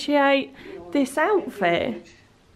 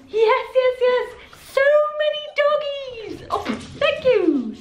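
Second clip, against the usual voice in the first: talking to dogs; 11 semitones higher than usual. Real, not acted. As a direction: coo at the puppies. Greeting the dogs.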